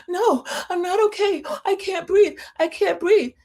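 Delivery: very high, put-on panicky wail; faked panic attack. Recapper mimics Margot answering Missy, mock panic. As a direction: imitate someone hamming up breathlessness. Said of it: "Margot was faking that panic attack".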